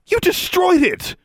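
high, slow outraged shout; angry shout. A narrator's reading. Girl raging over her shattered broom, angry shout. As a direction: shout the accusation in fury. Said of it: "she shouted".

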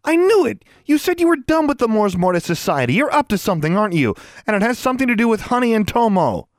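high, loud indignant outburst; accusing. A narrator's reading. Girl catches roommate sneaking out of bed, accusing. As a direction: fire it at them as an accusation. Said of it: "looking at Alexandra accusingly".

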